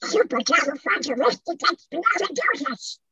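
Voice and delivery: high buccal cartoon duck voice; Donald Duck voice. Shown as a demonstration. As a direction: squawk the whole word like a cartoon duck. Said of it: "what about".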